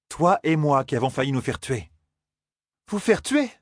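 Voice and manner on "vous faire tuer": a high, rising alarmed moan. A narrator's reading. Elaine hearing of the murder attempt, high moaning question. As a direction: moan it out in dismay. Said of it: "gémit Elaine".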